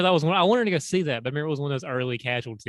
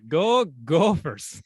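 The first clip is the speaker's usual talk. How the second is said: very drawn-out, louder mock Minnesota cheer; weird Minnesota accent; 3 semitones higher, 5 dB louder, much slower than usual. Replaying the chant stuck in his head, weird Minnesota accent. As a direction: drag out each word in a joke Minnesota accent. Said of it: "I always go".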